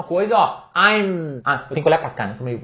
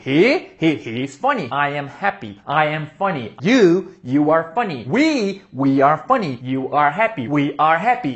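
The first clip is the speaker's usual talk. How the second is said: mock practice drill; 4 semitones lower than usual. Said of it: "feito um maluco falando".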